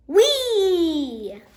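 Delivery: high, loud joyful whoop; gleeful whee. A narrator's reading. Dad pretending to fly, whooping with joy. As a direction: cry out with glee like a child flying.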